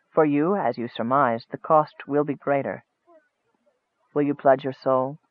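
low, hushed wizard's warning; soft. A narrator's reading. Wizard warning Michael of the cost, soft and low. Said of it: "said the wizard softly".

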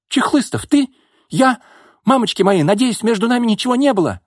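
high, loud panicky voice; panicked. A narrator's reading. Panicked waking next to him. (паника в высоком голосе)

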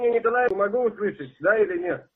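loud insistent question; shouting. Real, not acted. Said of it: "не ори на меня".

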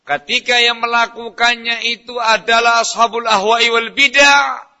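forceful preaching. Real, not acted. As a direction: preach it with rising force, stretching the end. (ceramah lantang dengan nada tinggi)

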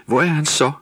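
a young elf near tears, lightly played; tearful cry. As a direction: plead as tears come. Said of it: "udbrød Jule med gråd i stemmen".

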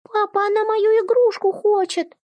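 put-on high complaining child voice; a child tattling to dad; whiny little girl. A narrator's reading. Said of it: "вдруг встряла Ира".

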